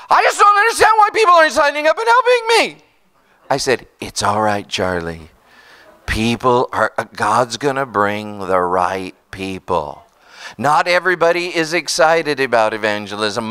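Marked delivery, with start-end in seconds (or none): 0.1-2.8 high, fast whiny complaint; Charlie's complaint. Retold or imitated. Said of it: "he goes". 4.0-10.0 calm him down gently; reassuring. Retold or imitated. Calming Charlie down.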